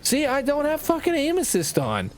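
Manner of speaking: a whiny nasal demo voice; nasally voice. Shown as a demonstration. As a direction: squeeze it through the nose, way up high. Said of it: "Here's a nasally voice".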